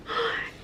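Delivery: quick, soft, high gasp; surprised gasp. Real, not acted. Finding the playbill in a friend's gift, surprised gasp. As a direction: gasp at a lovely find.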